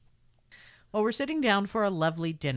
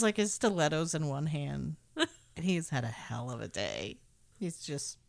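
ordinary talk

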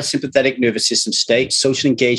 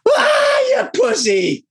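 much higher, scornful shout as the dad; mocking dad; slower than usual. Dad mocks his son crying at King Kong, high scornful shout. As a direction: jeer at the crying boy like his old dad. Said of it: "my dad would take a beat and go".

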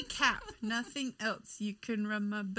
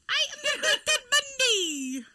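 much higher and louder, laughing grandly; hammed-up grand proclamation; 13 semitones higher, 9 dB louder than usual. Friend puts on glasses, loudly proclaims herself the great Ted Bundy. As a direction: boom it out like a silly showman.

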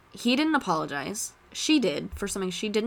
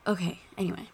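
breathy whisper to herself, moving on; whispered aside; 3 dB quieter, faster than usual. Real, not acted. Essayist cutting her own tangent short: whispered aside. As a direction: drop to a whisper and wave it off.

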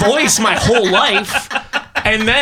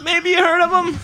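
mock Dracula; 11 semitones higher than usual. Dracula bit, high voice.

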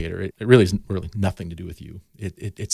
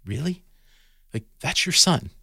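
higher, rising mock-astonished question; surprised disbelief; 9 semitones higher than usual. Gardener amazed the mom's son is that kid, higher disbelieving question. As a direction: retell your own shock, pitched up. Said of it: "I'm like".

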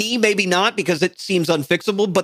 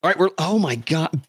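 ordinary delivery

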